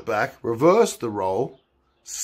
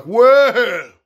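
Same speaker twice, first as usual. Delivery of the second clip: mock surprise; 10 semitones higher, 8 dB louder than usual. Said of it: "the judge is like".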